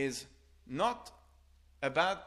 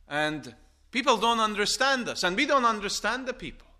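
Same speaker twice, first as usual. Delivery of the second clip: loud, raised preacher's voice; emphatic preaching; 7 semitones higher, 9 dB louder than usual. Real, not acted. Preacher telling fellow pilgrims they are strangers, loud and emphatic. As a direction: drive it home, high and loud.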